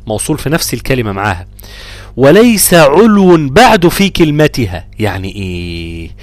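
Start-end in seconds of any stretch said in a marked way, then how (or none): 2.2-4.9 slow, chanted line of poetry; reciting verse. Shown as a demonstration. Teacher recites the rule verse, slow chant. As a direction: intone the verse slowly.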